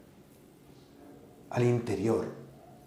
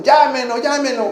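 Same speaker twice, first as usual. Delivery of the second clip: high, louder calling; urging shout; 12 semitones higher, 7 dB louder than usual. Retold or imitated. Host urging the children to call him, high and loud. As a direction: rouse the kids to shout.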